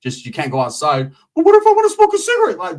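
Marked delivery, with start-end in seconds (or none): 1.3-2.6 high, loud, fast whine; whiny cousin. Retold or imitated. Cousin whining about having to stay inside. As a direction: whine like a kid. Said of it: "cousin whining".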